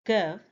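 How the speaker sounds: "gov" said alone as one syllable; isolated first syllable. Shown as a demonstration. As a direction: sound out just the "gov" of government. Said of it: "The first syllable is".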